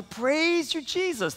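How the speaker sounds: high, excited cry of praise. Retold or imitated. Grandmothers getting excited, crying praise you. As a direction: let the praise burst out high. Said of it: "getting more excited about it and say".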